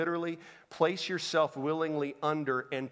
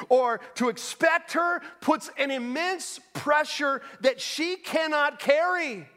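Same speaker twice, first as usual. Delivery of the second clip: high, emphatic pulpit delivery; impassioned preaching; 10 semitones higher, 5 dB louder than usual. Real, not acted. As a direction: preach it hard, pitch up, landing on key words. Preaches at full force.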